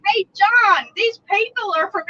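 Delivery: loud, high, drawn-out holler to a coworker; teasing call-out. Retold or imitated. Girl messing with John over Illinois, teasing call-out. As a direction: call it out slowly to wind him up. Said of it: "I'm gonna mess with him".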